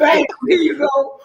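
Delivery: loud, high, laughing hand-off; playful shout. Pretend. Mock handing out diplomas.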